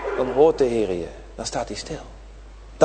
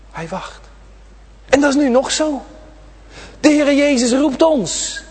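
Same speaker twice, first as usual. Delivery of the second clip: raised, ringing sermon voice; fervent preaching; 8 semitones higher than usual. Acted. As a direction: preach it out to the children, high and carried. Preaching on waiting Jesus.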